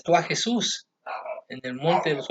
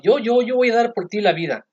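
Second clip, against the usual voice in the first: eager protest; 6 semitones higher, 3 dB louder than usual. Acted. Peter protesting loyalty.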